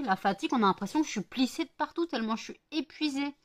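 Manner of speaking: ordinary talk, nothing marked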